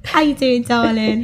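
high-starting Essex greeting; playful Essex hello; Essex accent. Shown as a demonstration. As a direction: put on an Essex accent, falling on darling. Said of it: "gonna do an Essex accent".

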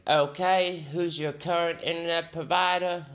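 lightly hammed British accent. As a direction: put on a prim British clerk's question. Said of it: "He was British".

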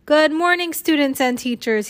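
high, loud morning announcement; announcer's greeting. A narrator's reading. Missus Stoker greets the school over the loudspeaker, announcer's greeting.

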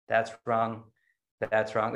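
low, deadpan teacher voice, dropping each verdict flatly; Brad Warner impression. Teacher inspecting the student's finished center, low, curt impression. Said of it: "This, this is my Brad Warner impression".

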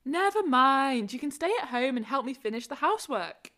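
usual speaking voice

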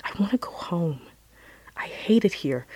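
ordinary talk, nothing marked

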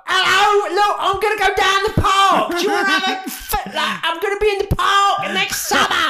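loud, high mock Cockney [name] announcement; [name] impression. Host mocking [name] announcing her Hyde Park shows, loud Cockney. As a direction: belt it like a brash Cockney [name]. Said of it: "She crashed websites just making".

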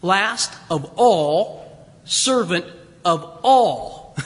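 each phrase drawn out slowly; slow emphatic repetition. Shown as a demonstration. Preacher stressing the repeated all, slow and weighty. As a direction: lean on "Last of all" and "servant of all", slowly. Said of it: "uses this word twice".

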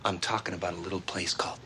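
low fast secretive whisper; dramatic whisper. Retold or imitated. Lloyd whispers the destination dramatically.

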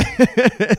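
a sudden high burst of laughter at himself; real laugh. Real, not acted. Telling friends about keeping the stolen hoodie, bursting into a high laugh. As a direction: laugh out loud at your own sneaky move.

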